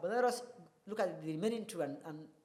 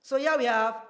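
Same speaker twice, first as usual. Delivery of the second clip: ordinary talk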